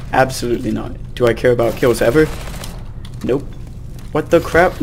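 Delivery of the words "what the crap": usual speaking voice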